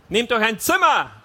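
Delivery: loud, high shout; calling down. A narrator's reading. Husband on balcony to kissing couple, shouting down. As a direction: yell it down to the street. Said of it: "hätte ich am liebsten hinuntergerufen".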